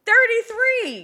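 high, slow outraged repeat; indignant emphasis. Real, not acted. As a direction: hammer the number in disbelief.

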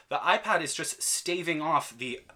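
ordinary delivery

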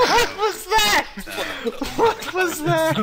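high-pitched laughing disbelief; cracking up mid-question. Real, not acted. Bonfire question cracks everyone up.

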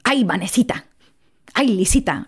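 affectionate mimicry of Colombian-accented Mom; lightly put-on Colombian mother's accent; imitating Mom's Colombian accent. Daughter imitating Mom to cut the tension, Colombian accent. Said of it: "just imitate Mom".

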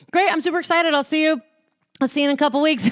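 super excited. Retold or imitated. Retelling own text to someone, super excited. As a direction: chirp it high, mock-eager.

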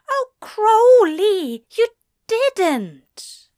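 high, loud, slow aghast angel; aghast cry. Acted. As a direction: draw it out, scandalised. Octopus discovered, aghast.

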